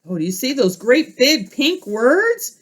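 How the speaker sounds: plain reading voice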